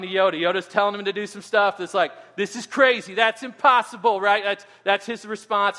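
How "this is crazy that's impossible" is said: mock whiny young Luke, a touch higher and louder; angsty teen protest, lightly voiced; whining Luke. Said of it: "He's just, like, whining".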